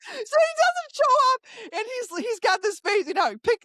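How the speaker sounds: can barely get it out for laughing; real laughter while telling it. Real, not acted. Laughing retelling of no-show.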